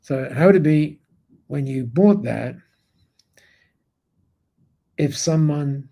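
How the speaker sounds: long silent pause after "bought that"; dramatic pause. Shown as a demonstration. Retelling the mentee's question, pausing at length after "bought that". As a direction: say "bought that", then hold a long silence before "if someone". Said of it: "just like I'm pausing now".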